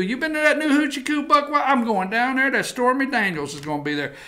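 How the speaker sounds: put-on good old boy talking himself into a night out, quick patter; mock Texan musing excitedly to himself; outraged Texas guy. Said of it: "we found the one guy in Texas that's outraged".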